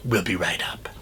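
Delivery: low, soft threat with a whispered name; lightly voiced sinister villain; quiet menacing call. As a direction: hush it down like a sinister caller.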